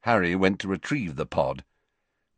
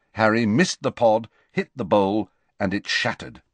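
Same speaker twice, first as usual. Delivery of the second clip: plain reading voice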